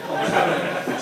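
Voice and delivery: ordinary talk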